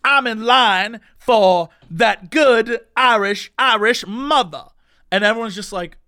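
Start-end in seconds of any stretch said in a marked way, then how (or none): 0.0-4.6 slow, drawn-out mock yell; imitated yelling. Drunk guy yelling in the line, slow louder imitation. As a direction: holler it slowly, relishing every word. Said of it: "keep yelling at each other".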